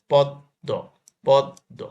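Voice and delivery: পদ্ম loud and split, pod-do; doubled দ. Shown as a demonstration. Teacher shows students the doubling, loud পদ্ম as pod-do. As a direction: hit পদ্ম hard with a held double d. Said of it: "সেই বর্ণ উচ্চারণে দ্বিত্ব".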